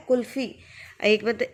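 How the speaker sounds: ordinary delivery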